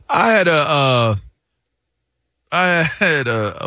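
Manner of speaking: ordinary delivery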